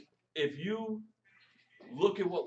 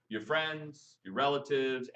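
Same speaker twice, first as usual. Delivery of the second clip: normal talking voice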